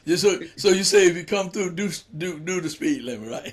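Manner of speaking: chuckling through a joke; warning about speeding, through laughter; laughing. Real, not acted. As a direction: tease him while laughing. Joking about speed trap.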